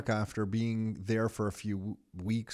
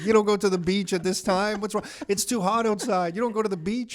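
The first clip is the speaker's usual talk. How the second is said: higher-pitched mock indignation; mock scolding; 7 semitones higher than usual. Seasoned visitor judging tourists headed to the beach, mock scolding. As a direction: tut at clueless tourists in a raised pitch.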